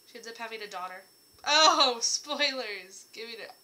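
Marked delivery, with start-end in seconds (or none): 1.5-3.1 mock alarm. Real, not acted. Catching herself again.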